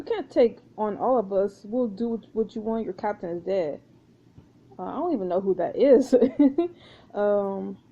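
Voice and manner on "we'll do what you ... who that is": ordinary talk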